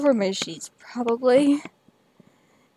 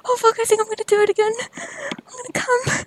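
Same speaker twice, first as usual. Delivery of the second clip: much higher, urgent cries; high urgent moans; 10 semitones higher, much faster than usual. Real, not acted. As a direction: build to the peak. Building to climax.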